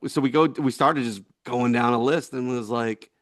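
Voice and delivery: ordinary delivery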